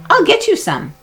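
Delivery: put-on high, eager little-girl promise; lightly voiced sister, cheerful; Annie's voice. A narrator's reading. Annie to dinosaur.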